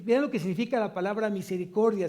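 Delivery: ordinary talk, nothing marked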